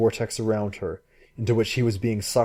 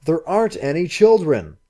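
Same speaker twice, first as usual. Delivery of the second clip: higher, louder exasperated shout; angry shout; 8 semitones higher, 7 dB louder than usual. Acted. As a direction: shout her down. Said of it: "he shouted".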